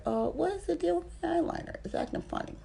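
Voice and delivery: high, puzzled aside; squeaky confused voice; puzzled self-quote. Retold or imitated. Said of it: "I was like".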